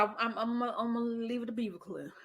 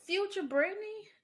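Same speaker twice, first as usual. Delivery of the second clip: much higher and louder, both words punched; emphatic; 11 semitones higher, 6 dB louder than usual. Retold or imitated. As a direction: ring out Future Brittany as a warning. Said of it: "lecturing Brittany".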